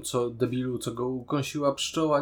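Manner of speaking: normal talking voice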